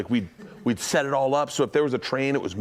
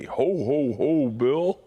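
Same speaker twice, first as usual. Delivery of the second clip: Santa voice; 2 semitones higher, much slower than usual. Pretend. Santa calling Bill.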